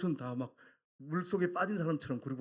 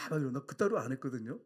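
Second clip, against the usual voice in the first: normal talking voice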